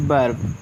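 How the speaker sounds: "वर्ब" with short a and light r, pitched low. Shown as a demonstration. Teacher sums up the third rule, "वर्ब" with short a. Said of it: "इस तरह से हम इसको पढ़ते हैं".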